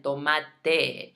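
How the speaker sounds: "Tomate" with a clear "teh" last syllable; careful three-syllable Spanish "to-MA-teh"; final E pronounced. Shown as a demonstration. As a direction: sound out the final E of "Tomate", never silent. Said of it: "always say the E's at the end".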